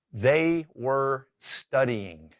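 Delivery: very slow, word by word; deliberate, drawn-out example sentence; slow model sentence. Shown as a demonstration. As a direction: stretch out each word for learners. Teacher sets up sentence to translate.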